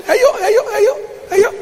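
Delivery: very high-pitched mocking phone chatter; mock phone caller. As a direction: mimic someone idly calling round, way up high. Mimics pointless phone callers.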